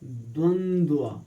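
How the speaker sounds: द्वंद्व said slowly, the double व clearly sounded at the end; slow careful pronunciation. Shown as a demonstration. Teacher to students, showing the correct word slowly. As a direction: draw out द्वंद्व so the last व is heard. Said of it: "वो कैसे लिखा जाता है".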